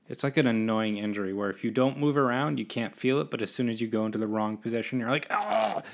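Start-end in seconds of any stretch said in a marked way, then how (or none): 5.3-5.8 high, strained "ah" of pain; acted wince of pain; mock pain groan. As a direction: groan as if the neck just seized. Acts hitting the painful position.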